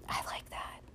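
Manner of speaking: soft, breathy whisper; a private whispered I like that; whispered aside. Real, not acted. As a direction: murmur it to yourself, pleased. Quiet satisfied aside.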